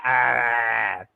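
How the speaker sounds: ah drawn out as a broad Boston sound instead of A. Retold or imitated. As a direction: say ah like a Bostonian dropping the A. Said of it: "You guys don't even say A".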